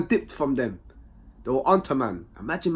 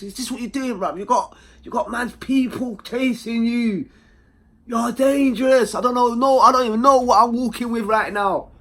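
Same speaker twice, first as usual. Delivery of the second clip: high, loud, slowed exasperation; mock exasperated; 10 semitones higher, 7 dB louder than usual. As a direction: scold her in disbelief. Scolding his girlfriend in retelling.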